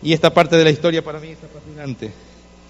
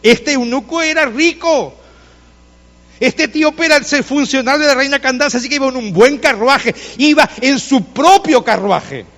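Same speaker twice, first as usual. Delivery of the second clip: deliberate preacher, pitched up; emphatic preaching; 7 semitones higher than usual. Real, not acted. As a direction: stretch the key words for the congregation. Preaches with emphatic, drawn-out phrases.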